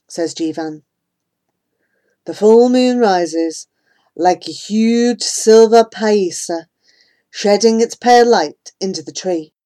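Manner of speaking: ordinary delivery, nothing marked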